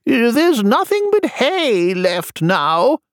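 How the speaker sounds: high, very slow character voice; high-pitched storybook messenger. Acted. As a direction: draw each word out up high.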